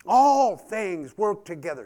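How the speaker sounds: sudden excited preaching shout; excited outburst. Real, not acted. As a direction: blurt it out in joy. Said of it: "I got excited, it just blurted out".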